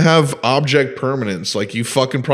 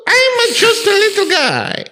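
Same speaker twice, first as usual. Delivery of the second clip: very high, slow cartoon squeak; hammed-up tiny plumber; mock Mario voice. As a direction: draw it out in silly falsetto. Mario parody continues.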